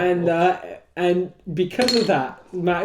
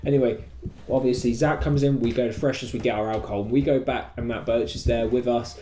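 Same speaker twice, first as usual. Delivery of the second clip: ordinary talk, nothing marked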